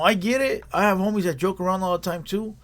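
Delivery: ordinary talk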